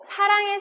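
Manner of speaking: high, projected 사랑해, first consonant lax s; plain s demo. Shown as a demonstration. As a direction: show 사랑해 with a clear plain s. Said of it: "구분이 안 된대요".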